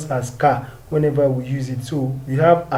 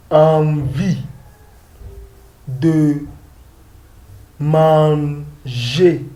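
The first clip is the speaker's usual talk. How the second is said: slow French, long pauses between words; deliberate, spaced-out French reading; word-by-word model reading; 5 dB louder, much slower than usual. Shown as a demonstration. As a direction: read it out for learners, one word at a time.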